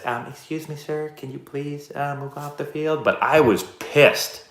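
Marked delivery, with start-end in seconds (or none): 0.0-3.0 softer, higher, overly polite joke version; mock polite. Coach joking about how his furious yell came out, mock timid and polite. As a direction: ask meekly, as if to please. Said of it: "it probably sounded like this".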